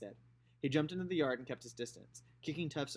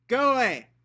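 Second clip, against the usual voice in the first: put-on loud, high child's yell; yelled go away; 10 semitones higher, 15 dB louder, slower than usual. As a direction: shout it through the closed door. Scout yelling through the door.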